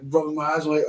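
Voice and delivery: ordinary talk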